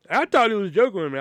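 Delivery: ordinary delivery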